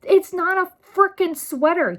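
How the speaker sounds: high, loud exasperated cry; outraged outburst. Real, not acted. Vlogger scolding parents who return children, in a high, loud outburst. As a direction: blow up at the absurdity.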